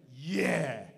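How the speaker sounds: put-on boy's loud, emphatic yeah. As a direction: blurt it out, impressed. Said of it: "And he went".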